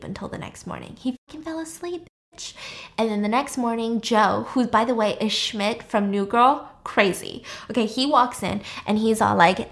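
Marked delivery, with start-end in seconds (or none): none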